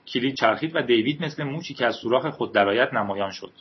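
ordinary delivery

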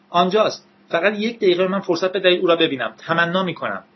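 high-pitched urgent appeal; pleading. A narrator's reading. Prisoner begs the agents. (با التماس و صدای زیر)

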